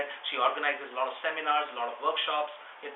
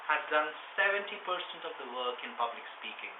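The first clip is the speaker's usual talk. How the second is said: ordinary delivery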